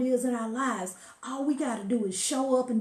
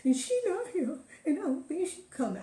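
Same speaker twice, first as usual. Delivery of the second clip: put-on higher, upset child voice through tears; a hint of a crying child, higher; 8 semitones higher than usual. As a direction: play the hurt little birthday girl on the verge of sobbing. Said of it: "But I'm crying".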